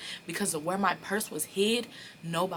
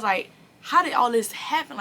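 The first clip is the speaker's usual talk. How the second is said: loud bafflement; 7 semitones higher, 10 dB louder than usual. Retold or imitated. Confusion at spread of gossip.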